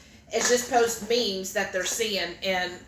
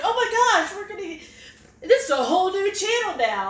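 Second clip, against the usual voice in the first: much higher, breathless fan excitement; imitating excited viewers; 8 semitones higher, faster than usual. Host mimicking viewers thrilled about the channel, high excited gush.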